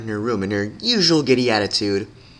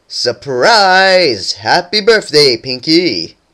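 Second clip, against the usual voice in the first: loud group-style cheer; surprise shout; 5 semitones higher, 9 dB louder, much slower than usual. A narrator's reading. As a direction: shout it out at the party. Friends shout surprise party.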